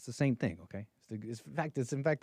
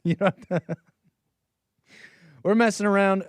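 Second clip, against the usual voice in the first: louder, higher, talking through laughter; laughing; 8 semitones higher, 6 dB louder than usual. Real, not acted. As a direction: laugh it off as just messing around. Laughs while admitting he's joking.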